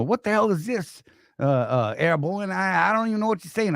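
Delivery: put-on high-pitched, hammy Boston accent; attempted Boston accent. Imitating Ranger Shelley baffled by the private, rough Boston accent. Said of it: "with his Boston accent".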